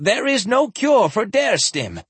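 high, desperate denial. Acted. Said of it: "Tanjin said desperately".

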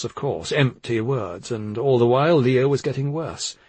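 plain reading voice

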